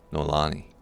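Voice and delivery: usual speaking voice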